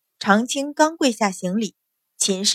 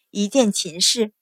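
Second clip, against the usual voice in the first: plain reading voice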